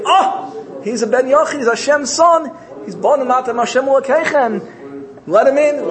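high, excited acted exclamation; sudden recognition. Angels suddenly recognize Hashem's son, high excited acclaim.

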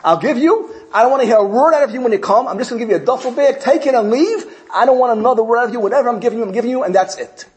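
high, fast, irritated brush-off; fed-up rich man. Retold or imitated. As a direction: snap at the pestering fundraiser. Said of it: "He said".

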